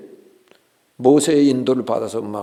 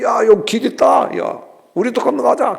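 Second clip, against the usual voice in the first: high, loud eager call; gleeful soldiers calling out, high and loud; excited shouting; 11 semitones higher, 6 dB louder than usual. Retold or imitated. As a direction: shout to your mates, thrilled to find a way. Egyptians calling across sea.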